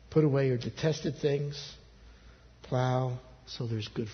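low, very soft, spaced-out phrases; hushed, tender appeal; gentle calling back. Acted. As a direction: let each invitation sink in quietly. God gently calls people back.

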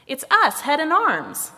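high, loud storybook answer; emphatic reply. A narrator's reading. Head introduces the pair to Body, emphatic reply. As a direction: announce who you are to a stranger.

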